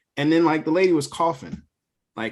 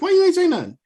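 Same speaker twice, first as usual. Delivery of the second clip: much higher, exasperated demand; retold outburst at wife; 5 dB louder, faster than usual. Asking his wife why she stayed silent, high and exasperated. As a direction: squeak it out, baffled and annoyed. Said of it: "I was like".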